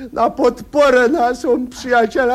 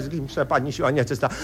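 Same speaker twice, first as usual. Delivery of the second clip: muttered curse; 7 semitones lower, 7 dB quieter, faster than usual. Acted.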